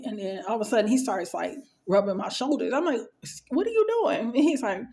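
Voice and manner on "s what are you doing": high-pitched retold "what are you doing"; taken aback, voice jumping up; startled objection. Patient to orderly rubbing her shoulders, startled.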